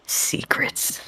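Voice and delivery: breathy secretive whisper of Secrets. Shown as a demonstration. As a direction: hush it to a whisper. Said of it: "whispered repeat".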